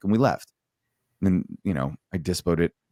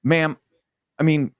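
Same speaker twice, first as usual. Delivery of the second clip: higher-pitched hesitant rookie; rookie piping up; 6 semitones higher than usual. Retold or imitated. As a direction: lightly imitate a rookie cutting in politely. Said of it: "he pipes up and he says".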